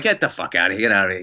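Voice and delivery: mock disbelief. Acted disbelief at being praised.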